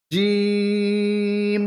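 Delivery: জিম held for three alif lengths, very slow; three alif stretch. Shown as a demonstration. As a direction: draw out জিম long and even. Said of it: "তিন আলিফ".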